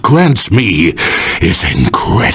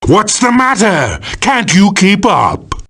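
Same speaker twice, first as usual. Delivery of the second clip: villain taunt; 6 semitones higher than usual. Acted. Villain taunts opponent in fight.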